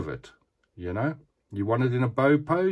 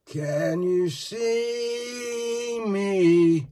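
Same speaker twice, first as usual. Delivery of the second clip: even more stretched, higher spooky call; slower, higher eerie call; 10 semitones higher, much slower than usual. Pretend. Calling to the figure a second time, a slower, higher eerie voice. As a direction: drag it out further, higher, teasing.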